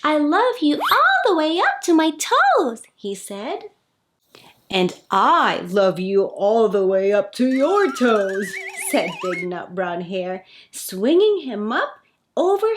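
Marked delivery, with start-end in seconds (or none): 0.0-2.8 little hare reaching up, voice raised high; eager bunny stretching up; excited little hare. Acted. 4.7-8.5 fond father hare, a touch fuller; gently proud big hare. Acted.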